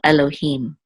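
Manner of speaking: "Elohim" with the weight on "him"; stress on last syllable. Shown as a demonstration. Teacher showing where the accent falls, final stress. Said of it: "accent is on the last syllable".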